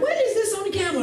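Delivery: high-pitched mock bewilderment; baffled surprise. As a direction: act out puzzled discovery, voice jumping up. Acting wife finding letter on camel.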